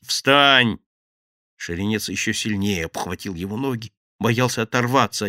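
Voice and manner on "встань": loud barked order; sharp command. Acted. Gang boss orders the grovelling thief up. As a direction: snap the order.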